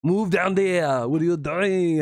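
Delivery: mock Arnold barking orders at Keith; Arnold impression. Streamer doing Arnold telling Keith to move, light Austrian accent. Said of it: "like Arnold".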